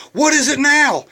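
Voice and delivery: loud, high exasperated outburst; irritated. Acted. Jogger going back to the crying woman, loudly irritated. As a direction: demand it in hammed-up annoyance. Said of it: "So he's so irritated".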